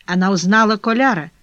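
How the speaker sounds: ordinary delivery, nothing marked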